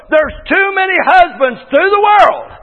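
ordinary talk

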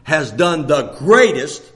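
slow, deliberate, low and loud; a hint of the sister's slow solemn telling; sister's exact words. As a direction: lean into the greatest slowly. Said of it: "this is the way she said it".

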